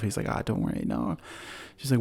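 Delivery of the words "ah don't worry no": low, soft mock dismissal; brushing it off. Man reassuring a kid, brushing it off in a low murmur. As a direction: imitate waving away a worry, low and quiet. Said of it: "brushes it off".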